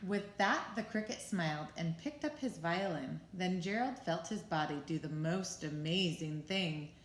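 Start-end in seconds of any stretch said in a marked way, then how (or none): none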